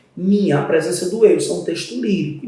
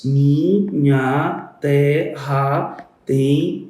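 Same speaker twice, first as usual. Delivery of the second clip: Mi, nha, te, ra, tem, palmeiras: each syllable spoken apart, palmeiras drawn out very slowly; split into syllables; 3 semitones lower, much slower than usual. Shown as a demonstration. Teacher slowly splitting the verse into syllables for students. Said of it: "Eu vou fazer a escansão".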